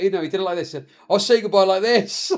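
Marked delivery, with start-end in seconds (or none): 1.1-2.3 quick, higher, through laughter; can barely get the son's line out for laughing; laughing while quoting. Retold or imitated. To viewers, retelling his son's line while laughing.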